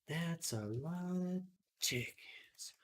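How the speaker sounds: slow, breathy whisper. Pretend. Streamer jokes to chat about chickens, whispering. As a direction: hush it slowly like a secret.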